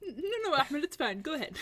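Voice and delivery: mild mock insistence; lightly mocking coworker. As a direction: wave him on like the pushy colleagues. Coworkers insisting Ahmed present.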